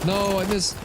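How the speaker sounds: high frustrated yell at a miss; frustrated outburst. Real, not acted. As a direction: blurt it out, annoyed and loud. Frustrated at missed shot.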